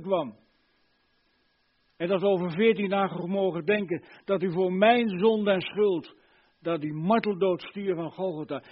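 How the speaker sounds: impassioned preaching. Real, not acted. Preacher marvels at Christ's death, impassioned preaching. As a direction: preach the wonder with full force.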